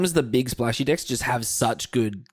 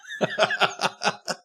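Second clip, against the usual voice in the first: high laugh. Real, not acted. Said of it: "laughs after droid wow".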